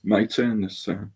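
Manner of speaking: normal talking voice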